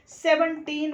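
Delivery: Seventeen with both seven and टीन stressed; stress on both parts. Shown as a demonstration. Teacher giving a word-stress example, seventeen stressed on both parts. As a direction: give seven and टीन equal weight in seventeen. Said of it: "दोनों पे ही stress है".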